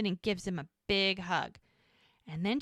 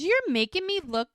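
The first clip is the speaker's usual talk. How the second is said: mock indignant; 11 semitones higher, 5 dB louder than usual. Charmaine protests to Jack in a high mock whine. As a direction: whine it like the wronged one.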